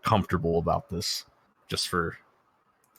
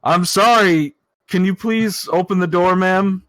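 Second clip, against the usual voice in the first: much louder, calling through a door; calling loudly; 10 semitones higher, 8 dB louder than usual. Acted. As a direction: raise your voice so she hears. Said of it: "I, I can't hear you".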